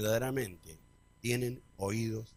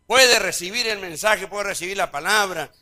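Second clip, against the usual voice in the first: preaching at full force; 7 semitones higher, 12 dB louder than usual. Acted. Preacher raising the message.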